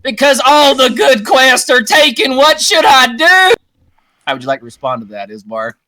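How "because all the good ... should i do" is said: high, loud, desperate shout; frantic villager cry; Klansman crying out. Acted. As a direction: plead with the grandmaster at full voice. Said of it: "man cry out".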